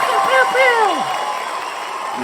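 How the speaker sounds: high, drawn-out laser zaps; laser pew sounds. Pretend. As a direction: zap like a toy blaster. Mimicking laser shots.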